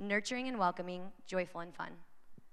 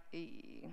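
cringe noise; 4 semitones lower, 11 dB quieter than usual. Pretend. Cringe at failing the list.

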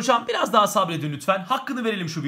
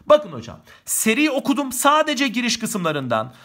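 normal talking voice

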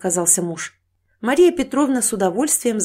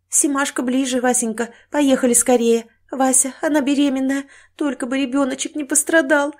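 high, worried urging; anxious, weepy kind woman; tearful; 6 semitones higher than usual. Acted. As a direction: plead with husband close to tears. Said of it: "расплакалась добрая женщина".

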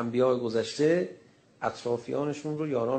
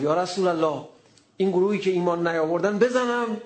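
louder, higher zealous plea; eager loud request; 6 semitones higher, 5 dB louder than usual. Retold or imitated. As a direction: ask fired up to fight them. Ammar eager to strike.

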